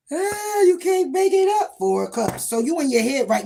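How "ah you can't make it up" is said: high, loud burst of delight; excited exclaim. Real, not acted. Reader reacts to the card, a high excited exclaim. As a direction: crow that it checks out. Card pull confirms her reading.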